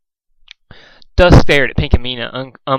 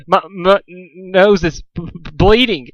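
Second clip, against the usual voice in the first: sobbing; 5 semitones higher than usual. A narrator's reading. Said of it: "he sobbed".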